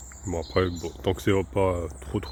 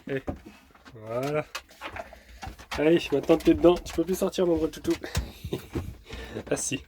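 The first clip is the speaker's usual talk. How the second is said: higher, playful teasing pet voice; talking to a dog; 5 semitones higher than usual. Real, not acted. Dog caught in shower. (taquiner le chien d'une voix aiguë)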